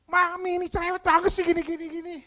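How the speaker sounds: high, loud whining complaint; mock bratty outburst; rude child's retort. Shown as a demonstration. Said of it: "tapi beda intonasinya".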